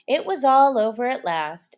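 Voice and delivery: ordinary delivery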